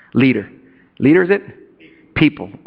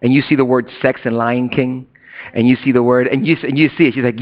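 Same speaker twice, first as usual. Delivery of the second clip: normal talking voice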